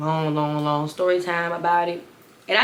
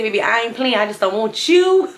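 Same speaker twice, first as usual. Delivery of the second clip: sassy quote; 4 semitones higher, 6 dB louder than usual. Retold or imitated. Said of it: "that quote that say".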